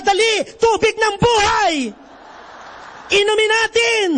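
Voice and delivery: hammed-up cry of joy; mock shout. Priest joking how the actor would shout about the water. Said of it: "baka sumigaw".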